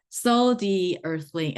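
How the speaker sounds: normal talking voice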